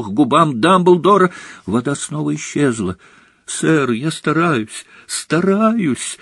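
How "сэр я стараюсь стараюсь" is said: high, drawn-out plea, climbing; desperate cry. A narrator's reading. Harry trying to get water for Dumbledore, desperate cry. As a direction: protest desperately, higher on the last word. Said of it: "отчаянно вскрикнул Гарри".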